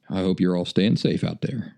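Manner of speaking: usual speaking voice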